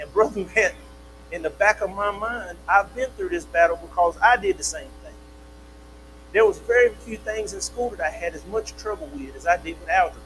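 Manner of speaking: ordinary delivery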